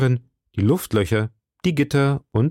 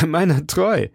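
laughing; 6 semitones higher than usual. Acted. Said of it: "entgegnete der junge Mann lachend".

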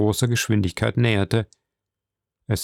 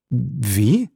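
feigned surprise. A narrator's reading. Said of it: "mit vortrefflich gespielter Verwunderung".

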